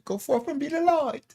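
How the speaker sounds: high, lilting British accent imitation; imitating a UK listener's sign-off in a British accent. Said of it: "she says with a U K accent".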